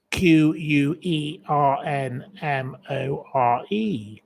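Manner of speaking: slow, letter by letter; measured dictation of single letters; spelled out. Shown as a demonstration. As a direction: spell it out slowly with each letter separate.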